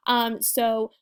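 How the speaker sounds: ordinary talk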